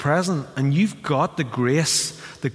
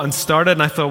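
ordinary talk, nothing marked

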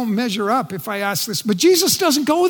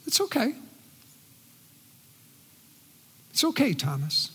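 gently; 11 dB quieter, faster than usual. Acted. God comforting the doubting Thomas, softly and gently. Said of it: "God gently says".

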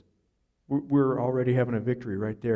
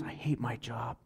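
mock whisper; 10 dB quieter than usual. Quoting dad's complaint about job.

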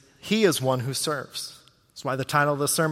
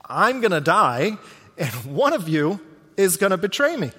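louder, raised, urgent declaration; dramatic announcement; 4 semitones higher, 4 dB louder than usual. Acted. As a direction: proclaim it like shocking news to the table. Jesus foretelling betrayal, dramatized.

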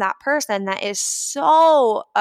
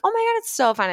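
fake-nice; 12 semitones higher, faster than usual. Acted fake-nice reply.

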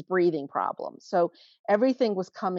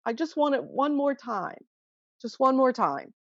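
higher-pitched pleading imitation of her son; whiny teen asking for just one more time; imitating son's craving; 6 semitones higher than usual. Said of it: "and it was the old, just".

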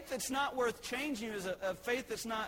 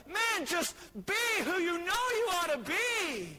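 high, loud preacher's exhortation; impassioned preaching; 7 semitones higher, 4 dB louder than usual. Real, not acted. Preaches at full force.

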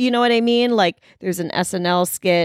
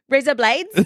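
"razor blades" in mock Australian, slightly higher; Aussie accent; 5 semitones higher than usual. Shown as a demonstration. Answering what she says for accents, Aussie accent. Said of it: "when you do an Aussie accent".

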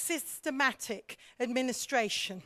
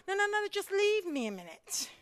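airy no no no, leave me be; mock reflective leader brushing people off; laissez-faire brush-off; 6 semitones higher than usual. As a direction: wave them off lightly, a bit higher. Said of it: "just give me a bit of space".

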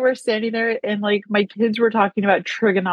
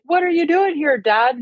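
a higher, surprised twin; surprised George; 6 semitones higher than usual. Retold or imitated. Twin finding his dad in the fireplace, pitched higher in surprise. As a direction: blurt it out, caught off guard. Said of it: "he was like".

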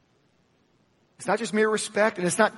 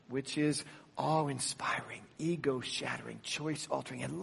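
choice-altering, said low and much softer; hushed; 4 semitones lower, 12 dB quieter than usual. Acted. Preacher to congregation, hushed choice-altering. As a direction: drop choice-altering down to a hush. Preacher repeats his list.

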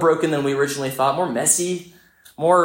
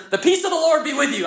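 imitated shout; 13 semitones higher than usual. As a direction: mimic someone calling out in a much higher voice. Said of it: "Who just shouts at one another".

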